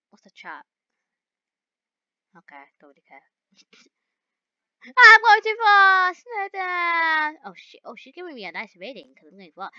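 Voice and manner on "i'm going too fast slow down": loud, high, drawn-out wail; panicked yelling. Real, not acted. Driver losing control of speed, panicked yelling. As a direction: wail it as the car runs away.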